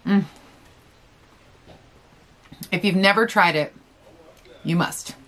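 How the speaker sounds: talking between bites; muffled chewing speech; mouth full, chewing. Real, not acted. Seen 2: Bites at -1.0; closed-mouth chewing, full cheeks, then talks between chews.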